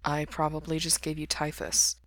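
soft, understated mutter. A narrator's reading. Sick man to visiting friend, muttering. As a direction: mutter it softly under your breath. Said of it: "Mikey muttered".

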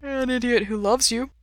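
high, yawned-through words; yawning. A narrator's reading. Sleepy lover answering partner, yawning. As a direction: say it through a big yawn. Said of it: "Ray says with a yawn".

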